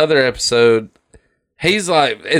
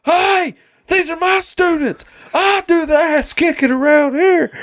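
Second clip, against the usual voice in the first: Buzzcut yell. Retold or imitated. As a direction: bark it like a drill-sergeant cartoon teacher. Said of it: "Mister Buzzcut runs in. He's like".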